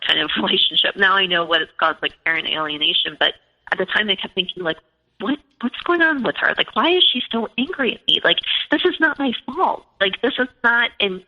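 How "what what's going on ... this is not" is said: high-pitched, exasperated questioning; retold baffled frustration; bewildered protest. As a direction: protest your innocence in a raised pitch. Retold bewilderment at daughter's anger.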